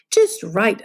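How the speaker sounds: usual speaking voice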